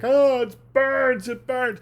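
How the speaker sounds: a melodramatic actor howling in pain; hammed-up burning agony. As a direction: overact being on fire like a bad actor. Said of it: "he emphasized the fire".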